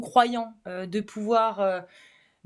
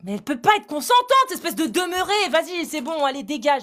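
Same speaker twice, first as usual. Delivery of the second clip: angry dismissal; 2 semitones higher than usual. Real, not acted. Debater throwing out opponent who argued consent, furious. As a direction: explode in disgust, then order them out.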